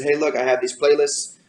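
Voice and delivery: ordinary delivery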